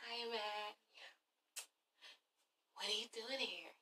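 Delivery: whispered greeting. Retold or imitated. Kenya whispering to Matt, surprised and hushed. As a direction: breathe the words out. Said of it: "She's like".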